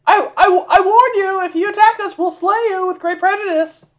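pompous warning to an ogre; high mock threat.

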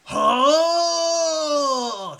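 long, high, loud shriek to the heavens; drawn-out, bewildered howl; confused bellow. Acted. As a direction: bellow it out, stretched and baffled. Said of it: "and shriek to the heavens".

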